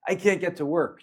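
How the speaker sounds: high, distressed plea; panicked. Retold or imitated. Woman phoning at five am, panicked plea. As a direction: voice her panic down the phone.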